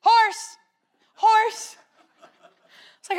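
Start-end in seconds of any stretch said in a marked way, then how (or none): 0.0-1.8 put-on high, eager little-kid calls of horse; mock childish horse-spotting; imitating excited little sister. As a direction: point out each horse like a thrilled child. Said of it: "she'd say".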